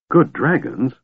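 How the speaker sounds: a touch of amazement; astounded. A narrator's reading. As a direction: disbelief at the words.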